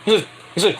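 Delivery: normal talking voice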